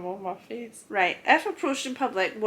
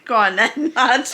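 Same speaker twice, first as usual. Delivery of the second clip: laughing mock reply; 3 semitones higher, 11 dB louder than usual. Mock answer to pickup.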